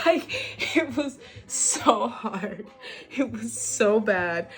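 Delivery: laughing through the words, slow; real laugh while saying how hard it was. Real, not acted.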